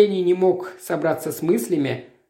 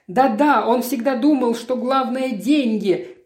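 moaning; 6 semitones higher than usual. A narrator's reading. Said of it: "простонала Натали".

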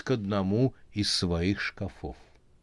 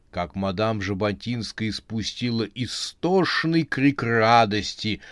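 usual speaking voice

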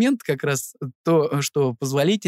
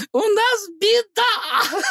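very high, slow wail breaking into laughter; laughing out loud; much slower than usual. Retold or imitated. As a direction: squeal it, losing it to laughter. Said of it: "И я просто в голос ржу".

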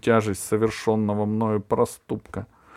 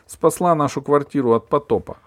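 decisively; 6 semitones higher, 5 dB louder than usual. A narrator's reading. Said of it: "решительно сказала мама".

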